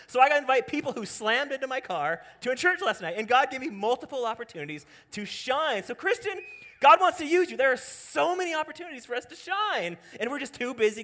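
loud, high-pitched preaching; impassioned pulpit shout. Real, not acted. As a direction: exhort the congregation at full force. Preaches at full force.

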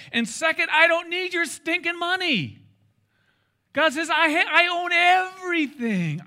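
loud, high, drawn-out declaration, climbing to a peak; booming indignant outburst, each phrase stretched; thundering divine rebuke. Acted. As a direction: proclaim it at full force as God correcting someone. Acts out God rebuking the stingy.

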